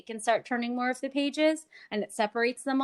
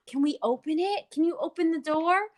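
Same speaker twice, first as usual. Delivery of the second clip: higher, eager questions after a gasp; animated toddler talk; 6 semitones higher, 3 dB louder than usual. Shown as a demonstration. Therapist demoing a flap book to a toddler, eager. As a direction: invite the child to open it. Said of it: "work on requesting".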